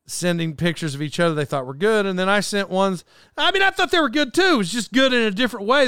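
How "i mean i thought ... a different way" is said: high-pitched, protesting self-defence; playfully indignant insistence; mock defensive. Real, not acted. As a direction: jump up in pitch to defend yourself. Defends his bad photos to friends.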